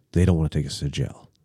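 low, quick, hushed report; grave disclosure. Retold or imitated. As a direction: break bad news under the breath. Friend translating the cops' grim plan.

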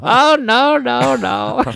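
high laughing oh no; groaning no no through a laugh; laughing dismay. Real, not acted. Realising he said it wrong.

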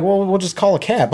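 lightly voiced grandparent; casual grandparent. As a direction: toss it off calmly.

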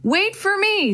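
high-pitched calling out; mild shout after them. A narrator's reading. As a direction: call after someone leaving. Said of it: "then shouted after them".

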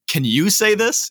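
high-pitched, pleading question; puzzled appeal. Retold or imitated. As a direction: ask a friend for help, pitch climbing. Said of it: "you just can't say that particular phrase".

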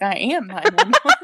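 joking to friend, loud proud declaration; loud punchline. Retold or imitated. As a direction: announce it like a proud boast. Punchline, retold inner reply.

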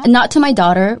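usual speaking voice

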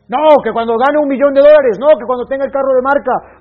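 mocking status-hungry people, loud; mocking excuses. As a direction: parody people chasing money.

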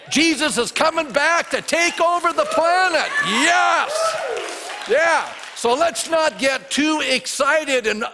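exultant high shout to the congregation; full-force preaching. Real, not acted. Preacher announcing Jesus's return, triumphant shouting. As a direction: belt out the good news.

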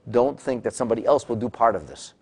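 ordinary delivery

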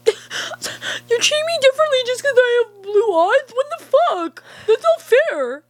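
hammed-up high indignation; mock outraged whine. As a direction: protest high and offended. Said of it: "they're all like".

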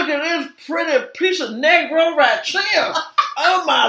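slow, high, loud ogling; leering admirer impression. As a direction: draw out the mock admiration. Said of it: "That's exactly how he sounded".

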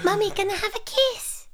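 put-on high old granny voice; an old lady imitating a little boy. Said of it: "He sounded like the granny then".

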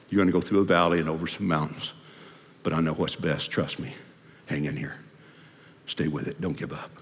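low, soft encouragement; quietly steady someone facing hard times; gentle reassurance. Acted. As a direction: voice sinking into tender assurance. God gently reassuring the believer.

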